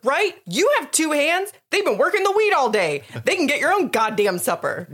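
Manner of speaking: loud, high, comic tirade; mock-angry rant. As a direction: shout him down with exaggerated indignation. Ranting back at Pa.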